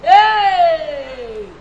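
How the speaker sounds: long, loud "Hey!" falling away; shocked cry. Acted. Madam reacting to news of suicides, shocked cry.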